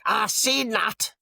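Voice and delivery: lightly voiced nosy stranger catching him out; mock accusing woman. Woman on the beach accusing him, in a mock high, slow voice. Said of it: "this woman just went like".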